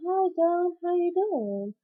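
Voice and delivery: high, lilting retold hello; mother's greeting. Acts out mother greeting her in dream.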